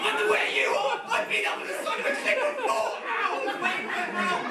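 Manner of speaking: high, laughing outburst of disbelief; indignant disbelief. Retold or imitated. Back at camp, answering a campmate, laughing in outrage. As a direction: throw it back at them, incredulous.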